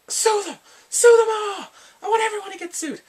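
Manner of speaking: high-pitched, very loud outburst; furious mock shout. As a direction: act out yelling in outrage. Acts out furious man demanding lawsuits.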